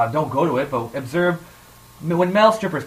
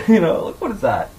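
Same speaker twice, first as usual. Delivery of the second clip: usual speaking voice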